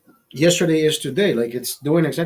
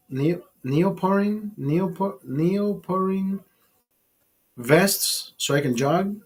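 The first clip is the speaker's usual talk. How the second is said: usual speaking voice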